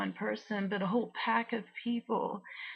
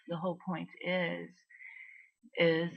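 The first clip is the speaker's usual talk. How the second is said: usual speaking voice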